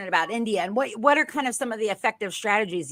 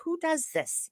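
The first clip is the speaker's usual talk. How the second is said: incredulous; 8 semitones higher, 6 dB quieter than usual. Retold or imitated. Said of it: "you think to yourself".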